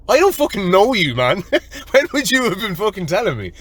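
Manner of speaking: high-pitched, amused disbelief; mock indignation. Answering the bird man in his head, mock indignant. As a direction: retell a baffled inner protest with a laugh.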